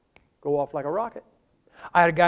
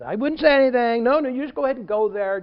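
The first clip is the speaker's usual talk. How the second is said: higher, louder mock protest; feigned innocence; 5 semitones higher, 5 dB louder than usual. Former member to an inquirer, feigned innocence. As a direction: lift it into showy false reassurance. Acted false reassurance.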